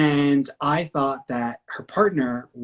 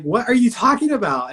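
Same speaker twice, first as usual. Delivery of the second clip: baffled question; 9 semitones higher, 6 dB louder than usual. Retold or imitated. Confused couple questioning her.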